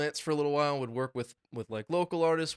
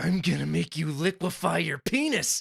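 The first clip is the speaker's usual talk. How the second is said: playful villain voice; 5 semitones higher, 4 dB louder than usual. Acted. Continuing the villain.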